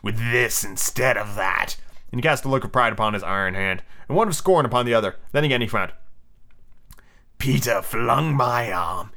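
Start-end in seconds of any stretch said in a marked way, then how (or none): 0.0-1.8 low, slow villain voice; drawling, grand captain; pirate captain. Acted. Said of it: "Captain said". 7.4-9.1 slow, drawn-out captain voice; slow villain captain; wincing Hook. Acted. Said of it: "he said, wincing".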